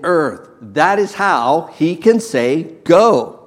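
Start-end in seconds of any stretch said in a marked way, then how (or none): 2.8-3.4 loud, higher single word Go; proclaimed command. Acted. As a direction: ring out Go. like a command from the pulpit. Preacher proclaims Jesus' command.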